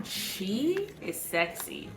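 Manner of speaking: a breathy-free slow seductive purr about the scent; sultry. Real, not acted. Describing perfume seductively.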